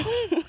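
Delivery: sudden high giggle; high burst of laughter. Real, not acted. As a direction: break into a giggle. Laughing at her own story.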